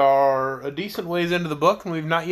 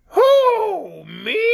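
very high, squawky bird-creature voice; startled squeal from a strange creature; shrill creature; 5 dB louder than usual. A narrator's reading. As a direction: screech it out shrill and high-pitched. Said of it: "in a shrill, high-pitched voice".

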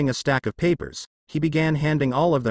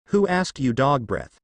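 usual speaking voice

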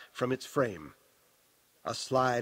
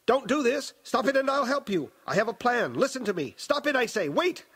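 high, frantic, desperate raving; 10 semitones higher, 3 dB louder than usual. A narrator's reading. Old Baron's voice begging Aaliyah not to jump, raving. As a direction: rave and bargain in mounting panic. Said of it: "but the voice still raved".

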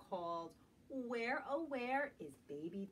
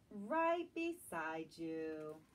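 slow, lower, soothing; tender reassurance; 7 semitones lower, much slower than usual. Acted. Mama Bear comforting her cub at bedtime, slow and tender. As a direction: gently reassure the little one. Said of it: "says Mama".

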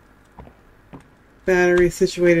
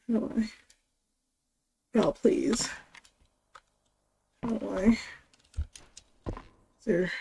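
much softer, barely above a breath; hushed and tense; 4 semitones higher, 12 dB quieter than usual. Real, not acted. Tense quiet searching.

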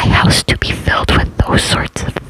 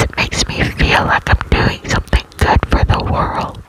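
usual speaking voice

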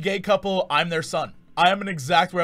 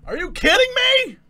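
very high, raised cry; exasperated disbelief; 5 dB louder than usual. Real, not acted. Exasperated at noise outside.

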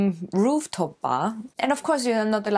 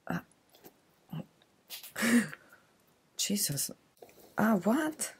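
breathless whispered shock; 3 semitones higher, 6 dB quieter than usual. Real, not acted. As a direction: gasp it out, stunned. Shocked by strange gift.

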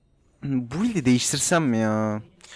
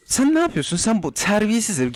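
much higher, hammed-up scolding; mock outrage; 8 semitones higher than usual. Streamer scolding the enemy player, hammed-up outrage. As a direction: scold him like a cheeky brat, overdone.

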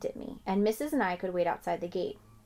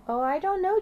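gently doubt the idea; doubtful farmer; 10 semitones higher, 5 dB louder than usual. A narrator's reading. Farmer hesitating kindly.

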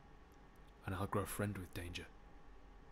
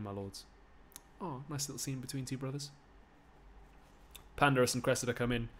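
plain reading voice

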